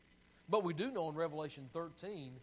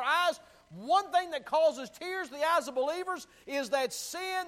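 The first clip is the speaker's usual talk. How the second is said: rising fervent sermon, louder and higher; preaching at full force; 8 semitones higher, 13 dB louder than usual. Real, not acted. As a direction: build the preaching to a shout. Preaching builds to climax.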